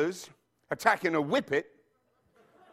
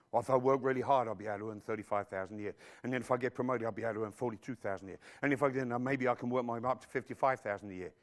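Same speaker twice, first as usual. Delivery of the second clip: lower, fast, muttering through the figures; mock worker's reckoning; 9 semitones lower, 6 dB quieter, much faster than usual. Preacher voicing a worker's small thinking, low fast mutter. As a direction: rattle off the salary plans under your breath.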